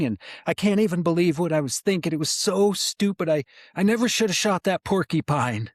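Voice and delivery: high, trembling voice, rattled and apologetic; shaky frightened kid. Retold or imitated. Teen hunter stammering his apology to the man he nearly rammed, high and shaky. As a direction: impersonate a scared teen gushing apologies. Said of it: "And he is shaking".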